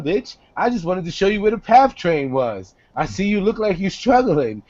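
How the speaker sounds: ordinary talk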